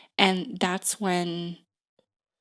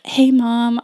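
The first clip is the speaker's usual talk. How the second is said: louder, higher call out to a parent; calling to mom; 5 semitones higher, 10 dB louder than usual. Acted. Teen leaving word for mom, mock calling out. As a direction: imitate a kid hailing their mom.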